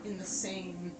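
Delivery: slow, hushed dungeon description; ominous narration. Acted. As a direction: build dread over the cages. Game master describes a creature.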